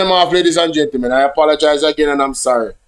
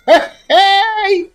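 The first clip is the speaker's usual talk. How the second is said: laughing. Real, not acted. Laughing at the bot.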